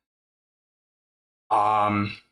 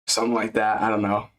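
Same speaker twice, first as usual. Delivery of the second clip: normal talking voice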